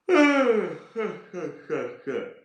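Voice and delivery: big, high, imitated yawning sigh; loud mock yawn-sigh. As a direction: ham up the man's noisy yawn. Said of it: "the bloke here kept going".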